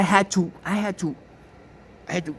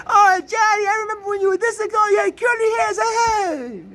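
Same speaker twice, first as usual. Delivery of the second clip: much higher, loud, gushing mock aunt with a sob at the end; gushing Italian relative; 4 dB louder than usual. Relatives in Italy fuss over young Johnny, high gushing mock voice. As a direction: ham up a doting relative pinching his cheek.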